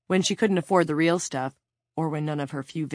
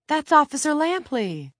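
high, raised anxious voice; urgent; 11 semitones higher, 5 dB louder than usual. A narrator's reading. Claudia to her unresponsive cellmate while shaking her, urgent. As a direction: urge someone to rouse, voice pitched up.

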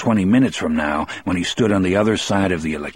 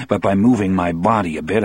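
plain reading voice

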